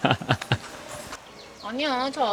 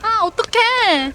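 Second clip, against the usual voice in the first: high, drawn-out cry of dismay; real "oh no" as rain hits; dismayed exclamation; 12 semitones higher, 5 dB louder, much slower than usual. Real, not acted. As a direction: wail it slowly, pitched way up. Sudden rain catches them outside.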